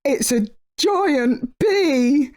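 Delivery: high, slow fearful wail; terrified cry. A narrator's reading. Bear fleeing the giant bee, a fearful wail. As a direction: wail in fright.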